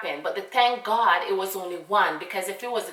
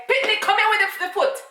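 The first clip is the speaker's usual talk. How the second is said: much higher, louder call of an impatient father; dad calling out; 14 semitones higher, 7 dB louder than usual. Retold or imitated. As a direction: shout for the child to bring the foot. Dad calling daughter over.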